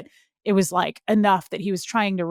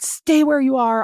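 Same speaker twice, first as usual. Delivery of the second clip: raised, louder voice of a protective father; higher, forceful retold instruction; urgent order; 6 semitones higher, 5 dB louder than usual. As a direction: imitate a firm, urgent command.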